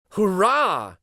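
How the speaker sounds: bright, high burst of joy; joyful cheer. A narrator's reading. Rabbit cheering at the news of the seaside. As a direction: cheer like a delighted toy. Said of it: "thought the little rabbit".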